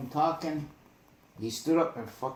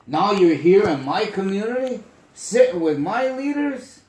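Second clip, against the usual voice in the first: raised, much higher and louder, a confronting call; retold shout at the meeting; 8 semitones higher, 12 dB louder than usual. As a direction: shout it at the visitor like a challenge.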